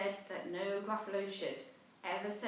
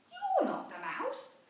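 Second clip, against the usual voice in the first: put-on high, disappointed child voice; a small monster let down again; little Gruffalo child. Child meets the owl.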